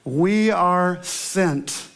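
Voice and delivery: We are sent said very slowly, each word weighted; emphatic slow theme line. Acted. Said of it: "set the tone for the entire year".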